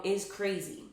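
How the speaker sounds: normal talking voice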